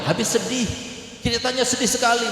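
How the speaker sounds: high, lightly whining wife voice; mock wife's teary excuse; imitating whiny wife. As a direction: pitch it up like a wife excusing her tears. Wife voiced answering her husband.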